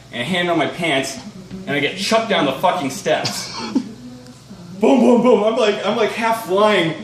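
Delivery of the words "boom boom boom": loud thudding booms. Retold or imitated. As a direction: boom it out loud and punchy. Tumbling down the steps.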